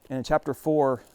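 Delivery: ordinary talk, nothing marked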